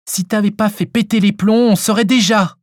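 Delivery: high-pitched, annoyed comeback; irritated retort. A narrator's reading. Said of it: "répliqua Chantal, énervée". (réplique énervée)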